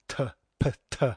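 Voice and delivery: bare "t, p-, t" sounds, separately popped; isolated consonants. Shown as a demonstration. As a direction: sound out t, p, t one by one. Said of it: "hard consonants".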